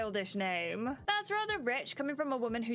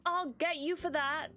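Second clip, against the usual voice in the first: playful threat; 7 semitones higher than usual. Acted.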